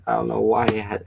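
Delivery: ordinary delivery, nothing marked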